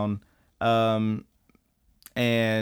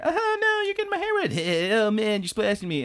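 high giggly falsetto squeal, mocking a couple; hammed-up squeals of a splashed girlfriend; playful splashing couple; 10 semitones higher than usual.

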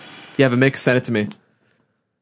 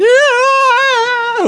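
very high, loud mock wail; off-pitch singing wail; 5 dB louder than usual. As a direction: imitate a singer wailing out of tune. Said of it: "She was always out of pitch".

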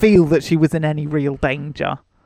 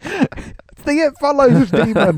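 excited; 9 semitones higher than usual. Retold or imitated. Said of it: "I'm like".

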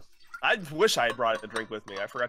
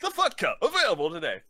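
much higher announcer sign-off; infomercial tagline; 6 semitones higher, faster than usual. Pretend. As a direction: sell the product name. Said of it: "End scene".

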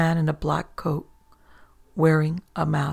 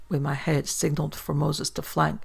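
ordinary delivery, nothing marked

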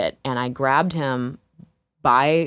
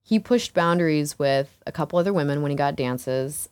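ordinary talk, nothing marked

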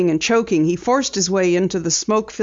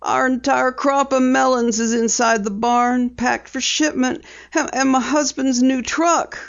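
farm woman voice; 6 semitones higher than usual. A narrator's reading. Distraught farm woman speaking.

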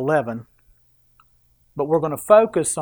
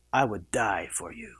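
put-on romantic accent; 5 semitones lower, 9 dB quieter than usual. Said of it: "you gotta talk in a real good accent".

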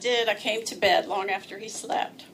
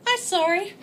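put-on high babyish whine; baby talk; 7 semitones higher, 3 dB louder than usual. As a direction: say it like a little child. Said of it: "spoken exactly like that".

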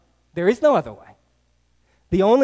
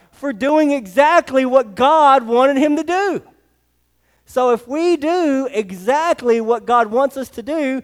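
preaching; 3 semitones higher than usual. Acted. Preaching with rising force.

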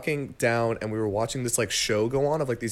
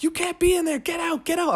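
much higher mock shout from the staff; yelling staff. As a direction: squeal at him to get out. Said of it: "And they're like".

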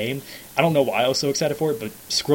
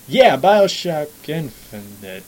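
jaded; 5 dB louder, slower than usual. Retold or imitated. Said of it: "I'm so jaded with everything".

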